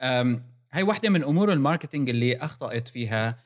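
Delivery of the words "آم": normal talking voice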